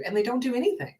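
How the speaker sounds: ordinary delivery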